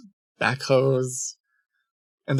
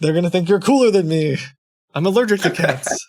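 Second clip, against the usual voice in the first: raised, louder playful protest; mock whining; 5 semitones higher, 5 dB louder than usual. Host jokingly sulks over guest's cat, mock whining. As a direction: complain in mock jealousy, pitched up.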